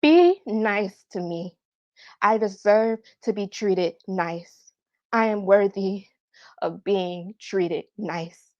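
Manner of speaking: slow, measured plea for respect; deliberate demand. Acted. Daughter telling mother she deserves nice treatment, slow and deliberate. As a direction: say it slowly, spacing out each phrase.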